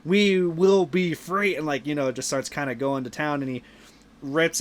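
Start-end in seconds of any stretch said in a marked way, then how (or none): 0.0-1.5 lightly hammed villain proclamation; slow, slightly raised mock-grand declaration; villain speech. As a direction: proclaim it slowly, like a mild villain. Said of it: "this whole big ol', like, villain speech".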